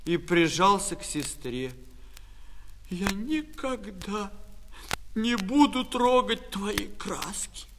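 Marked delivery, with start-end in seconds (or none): 2.9-7.7 slow, halting sobbed vow, rising high; acted crying, soft and slow; tearful promise. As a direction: promise through tears, drawing the words out. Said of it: "Алёша ещё громче заплакал".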